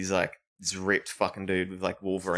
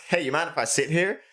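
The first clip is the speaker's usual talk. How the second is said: awkward brother; 8 semitones higher than usual. Retold or imitated.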